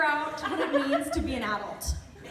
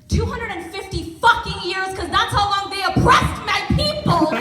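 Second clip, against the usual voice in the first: loud, raised comic rant building in force; indignant outburst; 4 semitones higher, 8 dB louder than usual. Acted. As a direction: erupt in righteous mock fury. Comic outburst answering a polite question.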